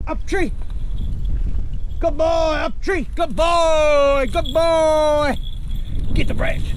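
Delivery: high, eager coaxing of the dog; excited dog praise. Real, not acted. Urging his dog up a tree, high excited praise.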